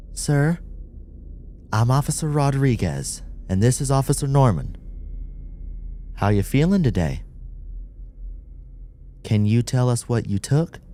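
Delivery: calm but firm police contact; officer voice. Acted. Officer addresses subject.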